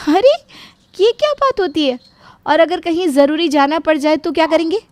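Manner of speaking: high, loud objection; indignant surprise. Acted. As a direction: protest in startled disbelief. Radha objects to the travel ban. (हैरानी भरा तीखा विरोध)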